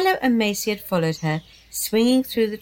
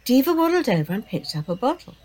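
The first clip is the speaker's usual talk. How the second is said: plain reading voice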